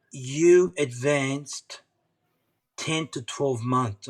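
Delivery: slow measured words; slow, deliberate. Retold or imitated. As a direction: spell out each word slowly. Physio spelling out the news.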